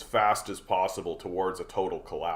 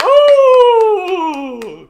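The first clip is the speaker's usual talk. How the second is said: much higher, much louder exaggerated "Oh!"; mock impressed gasp; 17 dB louder than usual. The crowd reacting to buzzwords with a much higher, louder gasp. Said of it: "people are like".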